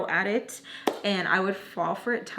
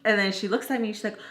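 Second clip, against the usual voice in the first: ordinary talk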